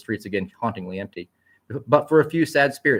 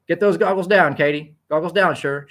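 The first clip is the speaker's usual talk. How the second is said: character voice; 7 semitones higher, 6 dB louder than usual. Acted. Characters voiced in read story.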